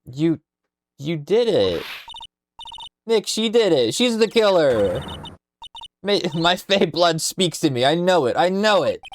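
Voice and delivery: high, loud, dramatic accusing cry; hammed-up, high-pitched outburst of certainty; frantic accusation. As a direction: accuse the killer at the top of your voice. Character accusing someone of murder.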